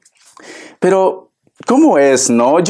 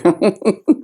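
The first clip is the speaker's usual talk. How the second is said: short laugh; 5 semitones higher than usual. Real, not acted.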